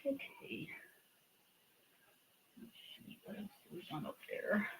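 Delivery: soft unvoiced whisper. Real, not acted. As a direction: whisper to yourself while working. Sudden whispering while shelving pencils.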